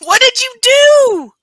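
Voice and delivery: high, loud, drawn-out disbelief; shocked exclamation. Real, not acted. Co-host reacting to friend's fire story, loud shocked disbelief. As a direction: cry out in real shock, stretching the words.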